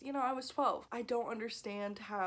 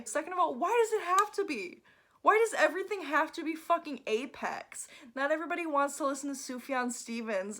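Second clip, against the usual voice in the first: higher, louder ranting questions; heated rant; 6 semitones higher, 5 dB louder than usual. Real, not acted. Vlogger ranting at snobby reviewers, higher and louder. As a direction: let the irritation boil over.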